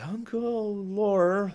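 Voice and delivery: put-on high, drawn-out little kid call; young child voice. Young child waking uncle in bed, high drawn-out call. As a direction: imitate a small child calling, long and high. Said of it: "an adorable young someone".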